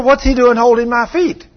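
high, loud retold protest; indignant question. Acting the woman's indignant question.

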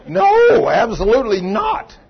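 loud, high, drawn-out "No"; emphatic acted denial. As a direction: cry out a comic, vehement refusal. Woman's vehement denial acted out.

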